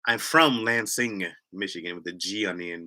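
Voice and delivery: normal talking voice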